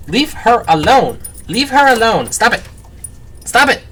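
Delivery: fed-up owner scolding; scolding the dog. Real, not acted. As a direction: order the dog off, rising each time. Scolding the dog mid-vlog.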